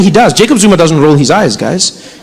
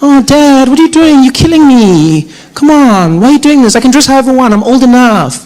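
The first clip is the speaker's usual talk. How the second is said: put-on higher, pleading teenage protest; whiny teen daughter; 4 semitones higher than usual. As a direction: whine at dad like a fed-up teen.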